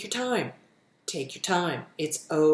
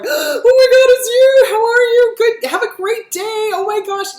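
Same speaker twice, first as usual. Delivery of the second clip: much higher and louder, squealing with delight; thrilled baby greeting; 13 dB louder than usual. Retold or imitated. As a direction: gush over a beloved baby. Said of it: "I'm always like".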